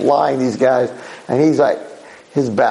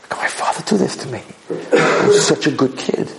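mock crying; 4 semitones higher than usual. Wronged son crying over what his father did, tearful. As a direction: voice breaking into imitated sobs. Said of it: "he's crying".

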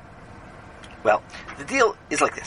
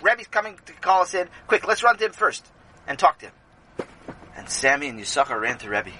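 ordinary delivery, nothing marked

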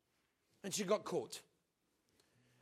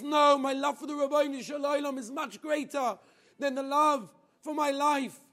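high, loud ringing declaration; impassioned vow; 6 semitones higher, 9 dB louder than usual. Retold or imitated. Girl defying the soldiers before death, ringing and loud. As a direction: proclaim it with all your heart. Girl's impassioned final refusal.

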